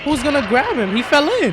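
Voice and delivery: high, loud alarmed outburst; shocked shout. Real, not acted. Gamer reacting to a child falling in, shocked shouting.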